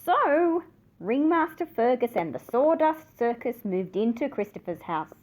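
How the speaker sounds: plain reading voice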